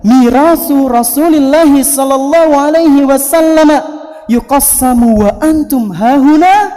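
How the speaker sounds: calling out. Acted. Abu Hurairah announces to the market, calling out. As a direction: proclaim it loudly to a crowd.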